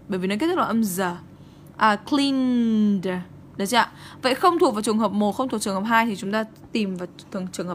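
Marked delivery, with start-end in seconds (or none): 1.9-3.1 "cleaned" drawn out into a voiced d. Shown as a demonstration. Teacher giving an example of the rule, "cleaned" with a clear d ending. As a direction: hold "cleaned" and release the final d clearly. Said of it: "chúng ta đọc nó là d".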